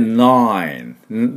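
"nine" drawn out long, ending on a firm n; stretched model "nine" with solid n at both ends; firm N sounds. Shown as a demonstration. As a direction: hold the word "nine" slowly with both n's clear. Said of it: "この音をしっかり出していきましょ".

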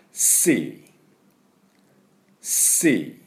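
シー with a long hissed s; model sea. Shown as a demonstration. Teacher leading students in repeating シー. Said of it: "私の後に発音いきましょう".

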